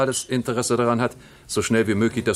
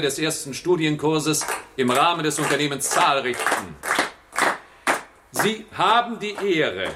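raised, ceremonial address to the guests; formal speech; 7 semitones higher than usual. Acted. The General addressing the first course participants, formal ceremonial speech. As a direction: deliver it like an official banquet speech.

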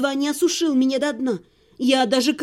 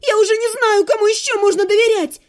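high, loud hysterical yell; girl screams for the king amid the chaos; shouting demand; 8 semitones higher, 5 dB louder than usual. A narrator's reading. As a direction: demand the king at the top of her voice. Hysterical cry.

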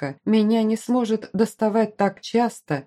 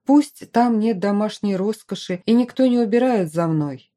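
ordinary delivery, nothing marked

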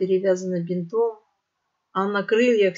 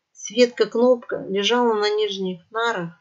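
ordinary delivery, nothing marked

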